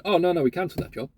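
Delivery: normal talking voice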